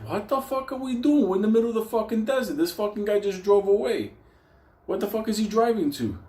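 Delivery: high, fast panicky rant; mock panic. Stranded in the desert, yelling his panic high and fast. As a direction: freak out in a shrill rush of words.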